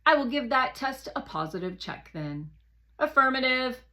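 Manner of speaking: a hint of a brisk machine confirming; Guardian's voice. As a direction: satellite Guardian confirming the test to the rover, lightly voiced. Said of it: "Guardian agrees".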